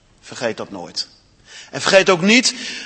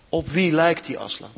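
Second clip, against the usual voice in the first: ordinary delivery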